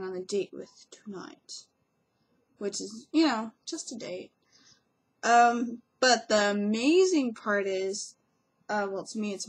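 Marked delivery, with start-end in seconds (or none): none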